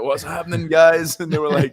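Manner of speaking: high, loud Scottish greeting; Scottish accent. Retold or imitated. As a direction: greet them brightly in broad Scottish. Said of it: "didn't know that I was Scottish".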